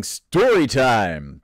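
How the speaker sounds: drawn-out mock announcer voice; big hammy announcer delivery, very slow; over-the-top announcer. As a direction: stretch it out like a ridiculous announcer. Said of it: "announcer quality of my voice".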